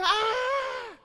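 high loud mock scream of a frightened passenger; imitated scream. Retelling the lady on the bumpy plane, a high shriek. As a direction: shriek like she thinks she's gonna die. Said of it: "she just yelled".

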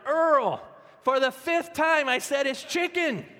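loud, high, drawn-out mock yell; hammed-up shout at a husband; exasperated wife yelling. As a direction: holler it slowly, fed up with repeating yourself. Exasperated wife yells the punchline.

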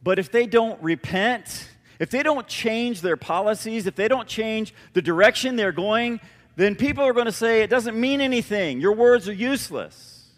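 loud, rising preacher's build to a peak; fiery sermon climax, pitch climbing; preaching at full force. Acted. Preaching about United Airlines repenting.

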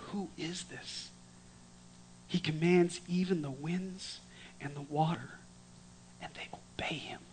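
hushed awestruck disciples; awed whisper. Acted. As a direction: murmur it in amazement.

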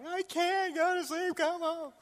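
high-pitched imitation of a pleading kid; mock panic. Daughter begs dad at the ER, mock-frightened whine. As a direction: whine it like a scared child. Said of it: "And she's panicked".

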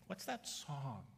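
slow, high mock-curious "What's that song?"; curious bystander. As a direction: ask it like an intrigued stranger. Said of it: "they're gonna go".

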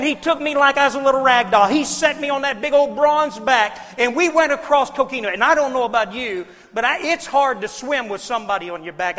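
loud, driving sermon storytelling; preaching at full force. Acted. Preacher telling his drowning story to the congregation, loud and driving. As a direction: preach it hard to the crowd.

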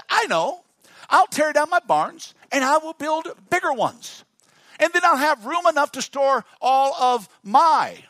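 high, loud rich man planning bigger barns; boastful rich man. Acted. Preacher voicing the rich man planning bigger barns, high and boastful. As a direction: pitch it up and boast. Rich man's scheming speech.

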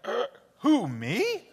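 high, surprised "Uh, who, me?"; innocent surprise. Shown as a demonstration. Teacher showing kids the Mary line, surprised. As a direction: act caught out and innocent. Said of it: "You gotta go like".

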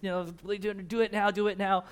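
hammed-up begging to a god; imploring prayer. Prophets begging Baal for fire, mock-pleading. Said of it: "they start praying".

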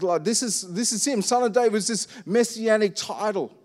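ordinary talk

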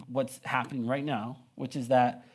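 ordinary talk, nothing marked

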